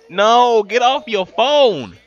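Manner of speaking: loud, slow scolding; irritated yell. Real, not acted. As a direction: order them off the phone. Yelling at character.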